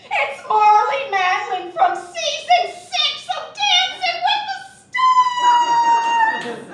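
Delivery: shrieking high starstruck fan; gushing fan. Retold or imitated. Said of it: "she was like".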